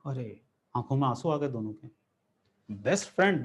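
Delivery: normal talking voice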